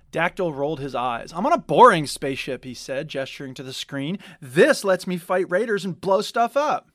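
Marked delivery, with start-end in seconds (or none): 1.3-6.9 loud, eager justification; defensive enthusiasm. A narrator's reading. Dactyl defending his game to Chirwa, eager. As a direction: sell the fun of the game.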